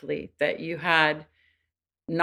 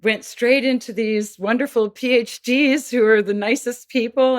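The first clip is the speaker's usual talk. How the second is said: mock dismissive people; 5 semitones higher, 4 dB louder than usual. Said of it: "people think".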